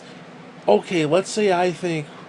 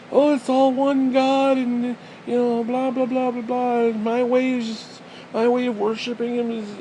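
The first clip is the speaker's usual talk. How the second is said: mocking believer impression; 9 semitones higher than usual. Man mimicking believers who say all one God, mocking.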